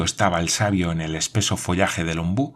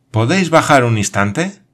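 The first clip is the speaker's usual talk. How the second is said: raised, higher call upward; 5 semitones higher, 6 dB louder than usual. Acted. Glenarvan asks Paganel to come down, called out loud. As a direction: shout the question up the tree. Calling up to the tower.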